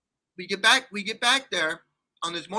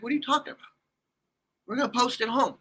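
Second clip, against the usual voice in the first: high-pitched, incredulous question; baffled disbelief; 7 semitones higher, faster than usual. Retold or imitated. Man questioning his girlfriend's plan to post it, baffled. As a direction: throw it back as a disbelieving question.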